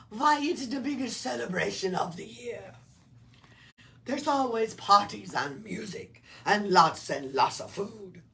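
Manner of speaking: loud, booming cheer; jolly Nutcracker. A narrator's reading. Nutcracker tells the lizard about Christmas, loud and jolly. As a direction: boom it out merrily. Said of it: "The Nutcracker laughed".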